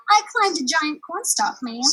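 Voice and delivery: put-on small girl answering the giantess; polite girl.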